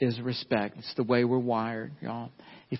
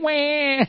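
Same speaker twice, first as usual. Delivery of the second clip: mock toddler wail; 11 semitones higher, 5 dB louder than usual. Toddler crying retold.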